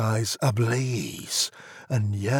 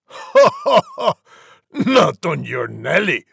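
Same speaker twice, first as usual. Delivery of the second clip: loud mocking wolf laugh, then a refusal; loud, high "ha ha ha" into a taunt; gloating wolf; 7 semitones higher, 8 dB louder than usual. A narrator's reading. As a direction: laugh it off as a big scornful wolf. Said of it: "The wolf replied".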